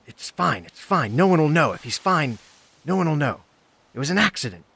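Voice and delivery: high, loud insistent denial; turning on him. Acted. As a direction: shut him down with forceful reassurance. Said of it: "turn on him and say".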